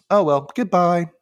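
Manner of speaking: high, drawn-out dismissive farewell; losing interest. Retold or imitated. Vink dismisses the stumped kids, losing interest. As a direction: brush them off in a slow singsong. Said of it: "Immediately loses interest".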